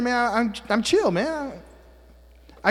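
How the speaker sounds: ordinary talk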